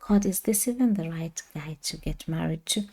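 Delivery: hushed question to God; inner thought. Retold or imitated. Thinking of papers on the way home, quietly asking God. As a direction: drop to a soft, private wondering. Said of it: "I thought".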